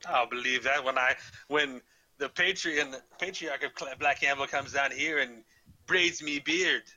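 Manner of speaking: scoffing, hammed-up Scottish burr; horrible Scottish accent. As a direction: doubt them scornfully in mock Scottish. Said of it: "back to my horrible Scottish accent".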